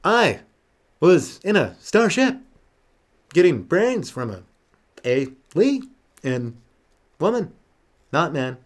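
slow, halting with long pauses; Shatner impression. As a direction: drag out each phrase like Shatner. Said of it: "William Shatner".

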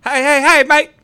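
manic, high, loud Aussie; hammed-up Australian holler; Australian accent. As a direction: shout it as a wired cartoon Australian. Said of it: "you sound like an Australian".

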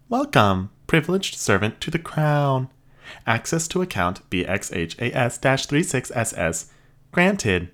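high, even robot voice; polite machine receptionist. Acted. Robot processes.

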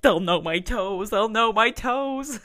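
loud, drawn-out comic worry; hammed-up paranoid fretting; mock panic. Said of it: "I'm like".

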